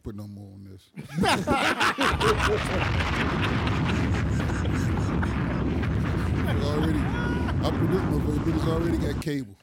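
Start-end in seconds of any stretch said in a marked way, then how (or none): 0.9-6.5 breathless wheezing laugh. Real, not acted. Laughing at his own joke.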